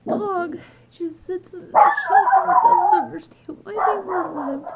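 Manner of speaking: real tears, pitch cracking upward; sobbing through the words; crying. Real, not acted. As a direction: voice breaking high and loud into a real sob. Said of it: "I think she knows I'm crying".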